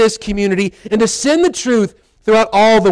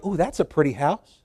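softer, half-breathed ooh over a house; soft admiring coo; 6 semitones lower, 9 dB quieter than usual. Retold or imitated. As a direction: coo at the house you pass, much softer. Admiring a house while driving.

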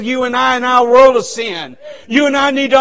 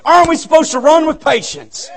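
raised, higher-pitched challenge to the congregation; insistent repeat; 5 semitones higher than usual. Shown as a demonstration. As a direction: press an inattentive crowd for an answer. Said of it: "they ain't paying no attention".

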